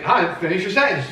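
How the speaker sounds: loud. Retold or imitated. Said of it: "I was like".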